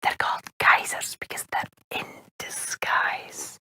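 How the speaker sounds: hushed secret whisper. Acted. Whispered aside.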